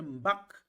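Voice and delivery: "mbak" said high and strong. Shown as a demonstration. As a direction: lift the voice on "mbak". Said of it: "Le ton est haut".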